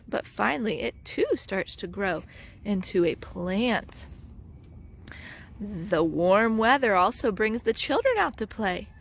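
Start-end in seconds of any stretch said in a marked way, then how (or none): none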